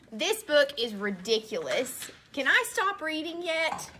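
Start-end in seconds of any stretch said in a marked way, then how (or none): none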